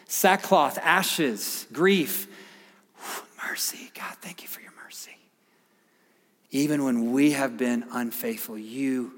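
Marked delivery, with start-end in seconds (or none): 3.5-5.2 hushed thanks to God, barely breathed; whispered prayer. Real, not acted.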